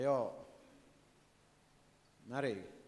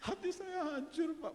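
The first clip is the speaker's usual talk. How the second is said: mock heartbroken; 11 semitones higher, 3 dB quieter than usual. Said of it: "Nunjuk di tengah".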